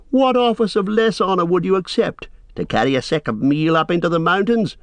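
high, sarcastic questioning; lightly scornful warrior; mocking. A narrator's reading. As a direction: taunt him with a lowly job. Warrior taunts cowardly counselor.

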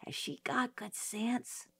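hushed whisper to a brother. Acted. Girl whispering to her brother in court, hushed. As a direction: whisper it so nobody hears. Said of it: "I whispered to Jim".

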